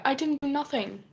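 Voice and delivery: high-pitched retold denial; acted-out pleading of innocence; mock defensive protest. Retold denial to accusing mother.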